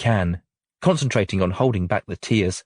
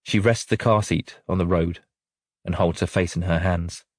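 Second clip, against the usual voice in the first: usual speaking voice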